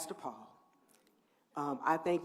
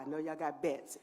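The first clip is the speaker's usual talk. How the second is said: ordinary talk, nothing marked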